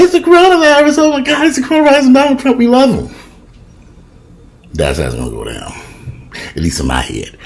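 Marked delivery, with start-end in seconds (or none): 0.0-3.1 very high, loud screaming crowd voice; hammed-up shrieking audience member; screaming fan. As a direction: squeal like a starstruck rally fan. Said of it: "the audience is screaming and hollering".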